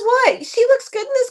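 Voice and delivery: very high, quick imitated voice; mock innocent protest. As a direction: squeak out her defence in mimicry. Voicing her defensive reply to Wyatt.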